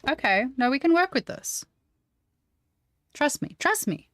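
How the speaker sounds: high, loud, excited insistence; insistent reassurance. Real, not acted. Streamer tells viewers the result is workable, high insistence. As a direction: pitch it up and insist.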